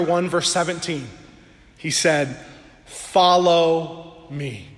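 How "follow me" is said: gentle call. Acted. Preacher voices Jesus calling disciples.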